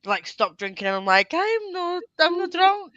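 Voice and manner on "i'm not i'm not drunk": mock drunk denial. Drunk protest retold to laughing friends.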